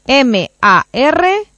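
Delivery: very slow spelled letters; over enunciating. Real, not acted. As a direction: drag out each letter. Deletrea despacio. (deletreo muy lento)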